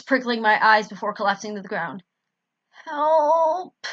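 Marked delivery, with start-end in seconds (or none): 2.7-3.9 croaked cry. Acted. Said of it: "I croaked".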